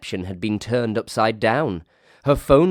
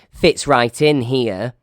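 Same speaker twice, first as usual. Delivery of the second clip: slow, loud, higher declaration; loud announcement; 5 semitones higher, 8 dB louder, slower than usual. Acted. Emily to her staff, announcing slowly and loudly. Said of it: "she announced".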